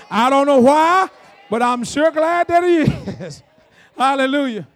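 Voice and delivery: shouted praise breaking into a laugh; exuberant preaching. Real, not acted. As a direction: rouse the congregation at full voice.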